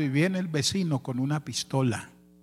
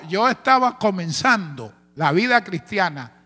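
ordinary talk

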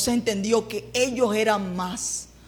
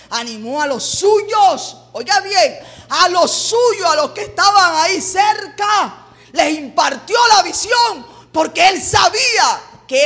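rising into high, loud preaching; emphatic preacher's shout; full-force preaching; 7 semitones higher, 6 dB louder than usual. Real, not acted. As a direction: drive each phrase home to the congregation.

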